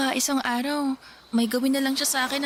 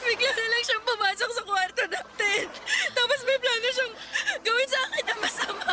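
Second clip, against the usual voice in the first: high, crying as she tells it; tearful; 9 semitones higher than usual. Acted. Mia tells her sister what Harold did, tearful. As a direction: sob out what happened.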